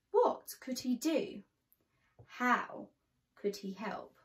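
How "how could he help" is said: plain reading voice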